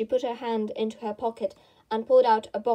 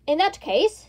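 a solemn, weighty opener; 6 semitones higher, 5 dB louder than usual. A narrator's reading. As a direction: speak it solemnly, like a chairman. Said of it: "said the Dodo solemnly".

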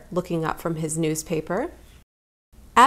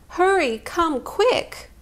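much higher, urgent scared call; frightened plea; 9 semitones higher, slower than usual. Acted. Scared cub begging parents to come quickly. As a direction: beg them to hurry, frightened. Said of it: "she cried".

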